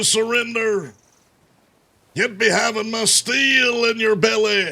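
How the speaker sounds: high, slow medieval town crier; mock olde english. Shown as a demonstration. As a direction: proclaim like an old herald. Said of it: "Medieval Lang voice line?".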